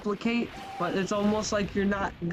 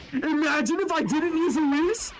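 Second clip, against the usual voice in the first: higher, silly mock-incredulous repeat; squeaky mock voice; 8 semitones higher than usual. Shown as a demonstration. Gamer teasing himself about skipping the Whis, squeaky mock voice. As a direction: say it again in a squeaky put-on voice.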